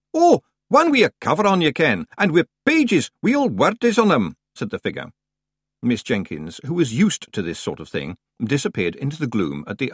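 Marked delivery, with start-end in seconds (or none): 0.1-4.4 rough Scottish brogue, louder; a rustic Scot at the door; Scottish-ish stranger. A narrator's reading. Stranger Scots.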